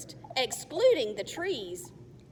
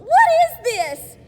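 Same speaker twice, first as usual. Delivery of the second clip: high, loud, slow shout; panicked yell; 14 semitones higher, 17 dB louder than usual. Acted. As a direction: cry out in alarm. Girl trapped in net.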